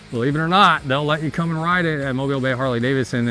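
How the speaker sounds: usual speaking voice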